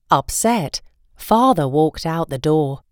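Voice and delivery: plain reading voice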